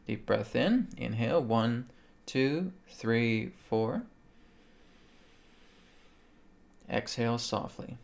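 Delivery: soft, quiet breathing guide. Acted. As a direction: count the breaths gently and softly. Leads a quiet breathing count.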